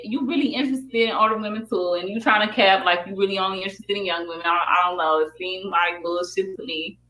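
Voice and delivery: normal talking voice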